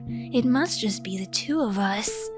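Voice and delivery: plain reading voice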